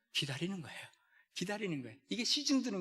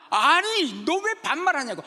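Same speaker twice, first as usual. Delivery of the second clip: loud, higher mock-indignant customer; annoyed customer; 10 semitones higher, 9 dB louder than usual. Pastor retelling the joke, indignant customer shouting. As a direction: demand an answer, half-joking. Customer angrily repeats complaint.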